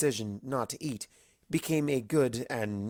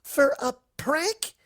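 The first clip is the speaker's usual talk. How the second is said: much higher, louder, slow disbelieving screech; 3 dB louder, slower than usual. Acted. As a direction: shriek it in disbelief. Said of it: "Twilight screeched".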